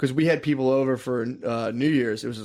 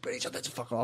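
much softer, urgent hush to his wife; hushed plea; 2 semitones lower, 12 dB quieter, faster than usual. Retold or imitated. Comic to wife as his video plays, hushed and urgent. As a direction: keep it down so the guests can't hear.

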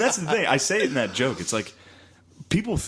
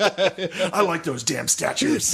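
slow, higher laughing mock voice; 7 semitones higher, much slower than usual. Parodying a right-wing guy, slow mock voice. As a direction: drawl it slowly as a caricature. Mocking a conservative stereotype.

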